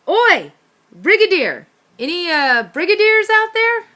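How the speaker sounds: loud, high call into the dark; shouted call. Acted. Rat calling into the dark park for the Brigadier, shouting. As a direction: yell out into the park to find him. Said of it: "I yelled".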